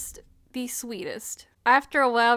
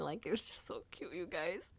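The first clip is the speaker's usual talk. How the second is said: hushed, low gush; overcome whisper; 4 semitones lower, 14 dB quieter than usual. Real, not acted. Fan telling viewers how cute it was, whispering.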